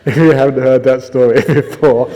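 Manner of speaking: talking through helpless laughter; can't stop laughing mid-sentence. Real, not acted. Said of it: "Sorry, I can't stop laughing".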